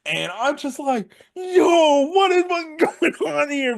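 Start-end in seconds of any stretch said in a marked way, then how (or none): 1.3-3.8 loud, very high yell of disbelief; losing it. Retold or imitated. Fan reliving the brawl on commentary, incredulous high yell. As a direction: yell it high, totally flabbergasted. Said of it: "I'm just losing it over here".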